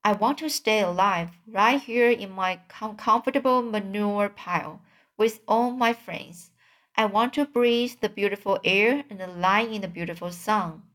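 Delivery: usual speaking voice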